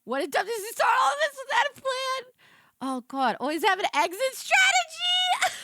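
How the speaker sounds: high, loud disbelieving cry; incredulous shout. Real, not acted. Blasting the studio for no plan, a high incredulous shout.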